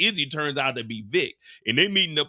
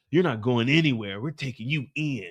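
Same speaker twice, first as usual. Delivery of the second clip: ordinary talk, nothing marked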